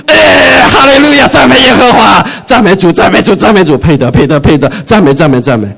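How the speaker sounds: high, loud shouts of hallelujah; joyful shouting praise. Acted. Congregation shouting praise to welcome God, high and loud. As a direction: cheer like a crowd welcoming a king. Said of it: "跟他讲说".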